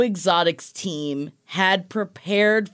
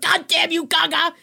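high-pitched exasperated cursing; mock outrage; 9 semitones higher than usual. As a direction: curse the singer in overwhelmed, high-voiced protest. Reacting tearfully to [name]'s anthem performance.

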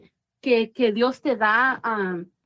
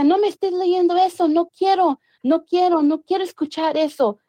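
higher mock yell, pleading to stop the reading; imitated mad yelling; 6 semitones higher than usual. Possessed man yells at the father reading the Bible, higher mock shout. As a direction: retell the possessed man's frantic shouting, pitched up. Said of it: "gritando como un loco".

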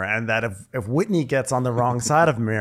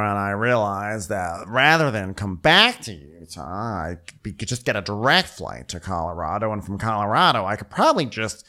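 mock long-winded rambling. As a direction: stretch words out as if never finishing. Rambling impression of Meredith, cut off.

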